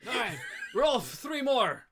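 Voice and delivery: high, loud order to the bartender; tavern patron calling out. Acted. Patron orders a round from Rolf, calling out. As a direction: shout the order over the bar noise. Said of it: "The dark-skinned man".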